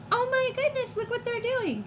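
usual speaking voice

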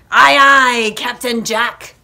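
loud, eager aye-aye, easing off; bright answer. A narrator's reading. Zach answers his captain's order, brightly and loudly. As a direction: punch the first words like a keen sailor. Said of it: "Zach answered brightly".

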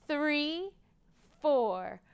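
ordinary delivery, nothing marked

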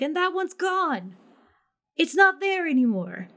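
slow, high, otherworldly guide voice; grave old guide voice. Acted. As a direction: lift the voice and slow down, solemn. GM voices NPC, that star's gone.

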